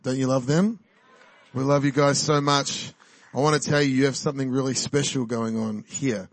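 ordinary delivery

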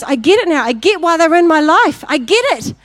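high, loud burst of understanding; excited realisation. Acted. Man answering God after the dream, excited realisation. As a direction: exclaim it as it finally clicks. Acts out man's sudden realisation.

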